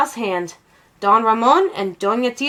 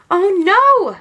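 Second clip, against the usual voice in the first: alarmed; 9 semitones higher, 5 dB louder than usual. A narrator's reading. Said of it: "said Mama".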